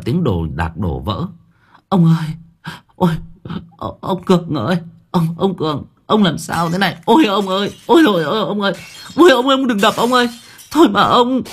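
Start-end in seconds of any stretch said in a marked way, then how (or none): 1.9-11.4 high, loud wailing lament for her husband; wailing and crying. Acted. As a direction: cry out in desperate tears, voice climbing. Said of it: "tiếng của bà Loan khóc lóc". (khóc lóc than van, giọng cao và to)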